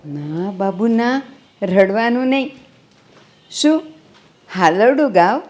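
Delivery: calm the little one gently; soothing baby. Acted. Grandmother hushing the crying baby.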